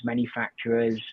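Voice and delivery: ordinary talk, nothing marked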